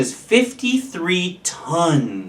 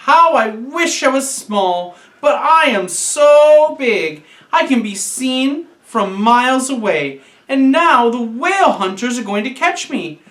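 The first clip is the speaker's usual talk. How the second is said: put-on despairing young whale; 7 semitones higher than usual. A narrator's reading. Young whale despairs, about to sob.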